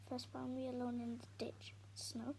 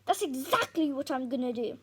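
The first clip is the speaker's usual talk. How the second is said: defiant; 13 dB louder than usual. Acted. Tom defying Dad.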